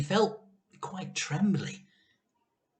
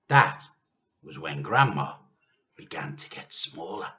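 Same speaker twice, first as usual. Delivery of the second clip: ordinary delivery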